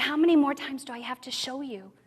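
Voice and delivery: unvoiced, hushed voice of God; soft whispered plea for trust. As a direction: whisper it gently, like a patient reminder. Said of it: "He said".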